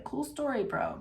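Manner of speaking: high, sarcastic brush-off; mock dismissive. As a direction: toss it off like an unimpressed bro.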